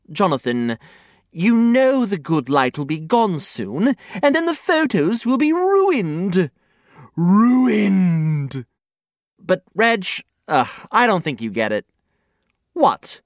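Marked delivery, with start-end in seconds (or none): 4.2-6.5 dramatic. Acted. Reg dramatizing ruined photos. 7.2-8.7 dramatic. Acted. Dramatic echo of ruined.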